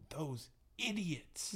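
put-on slow, high, soft child voice; kid's swear word. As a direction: savour the naughty word like a boy. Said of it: "cuando pide permiso dice".

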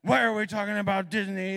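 high, loud mimicry of dad's grumbling; mocking daughter. Daughter parodying grumpy dad for laughs, mocking voice. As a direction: exaggerate it like a kid making fun. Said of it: "she's totally making fun of me".

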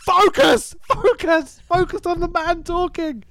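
high, exasperated re-enacted scream; screaming at screen. Viewer screaming at computer over blurry film, retold scream. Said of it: "I was screaming at my computer".

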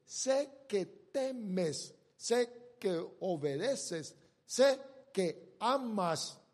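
each sé stretched and hit, very slow; stressed slow repetition. Acted. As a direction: lean on every sé, slowly.

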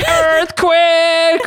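high, loud hammed-up cry; dramatic. [name] falling down repeatedly, announcing it dramatically and loud. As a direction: overplay the alarm. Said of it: "[name] said dramatically".

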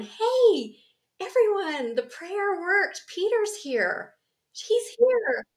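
put-on high, loud girl's announcement; a little girl calling everyone in thrilled; excited little girl shout. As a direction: burst in with the news. Rhoda announcing Peter.

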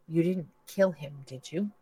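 plain reading voice

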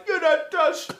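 high, laughing mock taunt. Mocking the Dubai fighter in a high laughing voice. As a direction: squeal it out through laughter.

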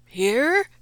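father blurts in shock; stunned gasp. A narrator's reading. Farmer sees procession turn in, startled. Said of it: "Buttercup's father managed".

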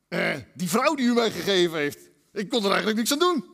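a sheepish husband pleading innocence; Adam excusing himself. Retold or imitated. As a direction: shift the blame onto the wife. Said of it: "zeggen ze".

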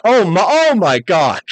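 mock-shocked, slow and strained; Vince aghast. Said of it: "even Vince was like".

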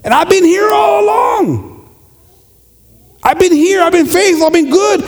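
high, loud protest, said slowly; indignant shout. Acted. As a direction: shout out how faithful you've been. Older brother protests, shouting.

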